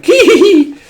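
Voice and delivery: high shrill creature cackle; creepy giggle. Acted. Spider guy giggling at the kids. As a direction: cackle like a gleeful little monster.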